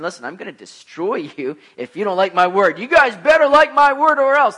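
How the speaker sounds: hammed-up angry God threatening the crowd; mock angry God. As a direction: thunder it like an angry deity. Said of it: "an angry God coming down and saying".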